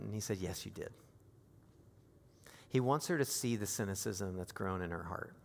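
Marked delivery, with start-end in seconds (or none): 0.4-0.9 much softer, lower, kindly; tender, patient God; gentle insistence. Retold or imitated. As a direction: quietly tell her you know.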